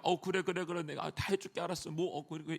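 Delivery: put-on soft, hurried giving-in to a child; hushed, appeasing mumble of agreement; placating parent. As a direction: voice a worried parent caving in, softly. Parent hastily placating a child's tantrum.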